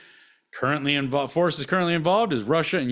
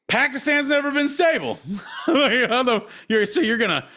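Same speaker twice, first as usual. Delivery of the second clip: high-pitched, half-laughing disbelief; laughing in disbelief at the absurdity; incredulous laughing; 8 semitones higher than usual. Real, not acted. Incredulous at Pakistan being listed.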